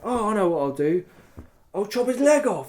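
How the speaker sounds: high, laughing gleeful plan; gleeful idea. Retold or imitated. Player deciding to chop the rabbit's leg, gleeful. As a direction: announce a wicked idea with glee. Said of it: "so I'm like".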